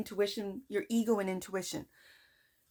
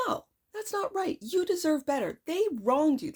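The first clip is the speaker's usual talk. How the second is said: a touch louder and firm, higher pitched; curt loud inner voice; 6 semitones higher, 3 dB louder than usual. Retold or imitated. Reader voicing her intuition, curt and firm. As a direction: inner voice standing up for herself. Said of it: "what I consider to be a loud voice".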